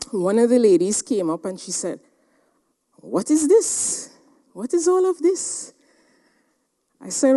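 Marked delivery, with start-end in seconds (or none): 3.0-5.7 a bemused villager staring at strange machines; baffled questioning, pitched high; puzzled villager. Retold or imitated. Village lady puzzled by gadgets.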